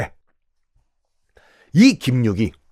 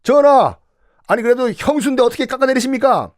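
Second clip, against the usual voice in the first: high, pleading remonstrance; protesting minister; 9 semitones higher than usual. Retold or imitated. Kim Yuk pleading with the king not to demote his sister-in-law. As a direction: appeal to the king's conscience. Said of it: "김육이 반대했어요".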